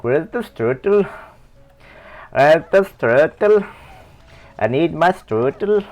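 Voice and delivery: playful fake German accent; mock German accent. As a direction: beg for strudel in a silly German accent. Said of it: "I'm doing a foreign accent".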